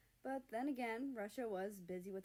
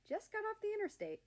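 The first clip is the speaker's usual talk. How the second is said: America's voice; 13 semitones higher, 3 dB louder, faster than usual. Acted. America on phone, voiced higher.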